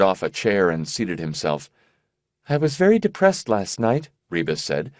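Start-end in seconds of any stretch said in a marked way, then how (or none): 2.5-4.1 lightly voiced sick young woman, high; airy, distracted girlish voice; high fragile young woman. Rebus voiced by narrator.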